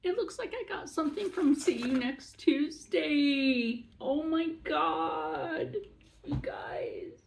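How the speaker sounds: high emotional voice, trailing to breath; real tearful amazement, giving out at the end; overwhelmed, near tears. Real, not acted. Vlogger telling viewers about an unexpected gift, near tears. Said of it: "I'm trying not to cry".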